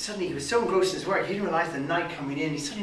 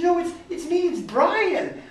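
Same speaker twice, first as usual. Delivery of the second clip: excited insistence; 11 dB louder, slower than usual. Acted. Brian insisting to the lads.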